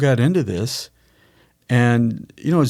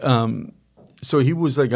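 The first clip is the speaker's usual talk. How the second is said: ordinary delivery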